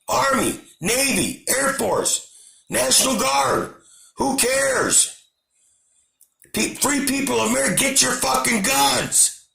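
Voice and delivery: a real angry outburst calling people to act. Real, not acted. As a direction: shout it out at the people in anger. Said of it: "Sorry for raising my voice".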